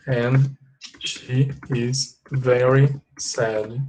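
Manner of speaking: very slow, each word set apart; slow dictation. Shown as a demonstration. Teacher adding to the example about Jane, slow dictation.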